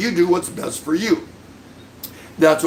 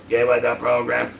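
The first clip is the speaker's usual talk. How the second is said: quoted catchphrase, low; 4 semitones lower than usual. Retold or imitated. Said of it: "move away from".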